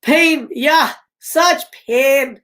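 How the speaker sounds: put-on high, drawn-out hammy Russian accent; attempted Russian accent. Reader plays Sydney rehearsing onstage, mock Russian accent. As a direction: ham it up with a badly faked Russian accent. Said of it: "Russian accent".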